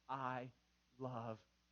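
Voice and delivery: low, soft, paused after the first word; solemn. Shown as a demonstration. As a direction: say it quietly and sincerely. Models the words for mom.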